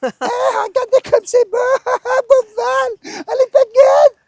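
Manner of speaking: shrill squeaky impersonation of his partner; high little voice. Retold or imitated. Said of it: "Avec une petite voix aiguë".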